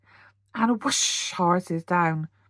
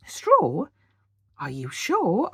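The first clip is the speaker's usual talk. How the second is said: rising, louder Straw?; doubtful surprise; 4 dB louder, slower than usual. A narrator's reading. Percy doubts his brother's straw plan, surprised. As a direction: question the straw, a little alarmed. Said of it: "asked Percy".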